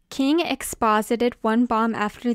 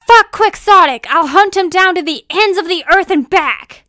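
high, full-volume vengeful shout; roaring, raised-pitch villain outburst; furious yell; 9 semitones higher, 13 dB louder than usual. Acted. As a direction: yell a threat in rage. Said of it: "yelled a villain".

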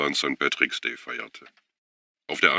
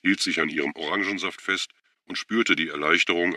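usual speaking voice

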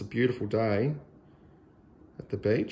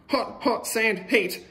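much higher, pained little cry; squealing about the heat; hot-sand squeal; 10 semitones higher than usual. A narrator's reading. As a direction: hop about on burning sand. Feet burning on sand.

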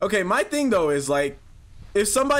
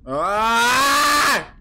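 startled yelp; 6 semitones higher, 5 dB louder than usual. Real, not acted. Startled in game.

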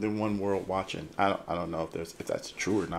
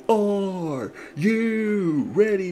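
high, drawn-out wrestler's roar; Triple H; 8 semitones higher, 6 dB louder, much slower than usual. Acted. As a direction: stretch each word into a loud ring cry. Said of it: "stands behind me".

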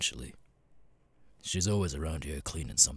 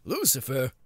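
surprised shout; 10 semitones higher, 4 dB louder than usual. Acted. Shocked at the newcomer's story.

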